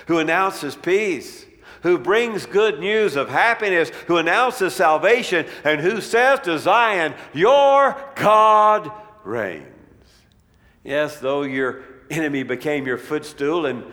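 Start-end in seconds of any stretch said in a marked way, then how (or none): 0.1-9.7 louder, higher, building to a drawn-out climax; preaching proclamation. Acted. Preacher heralding good news to Zion, triumphant proclamation. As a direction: proclaim it, stretching the final words. Proclaims scripture at full force.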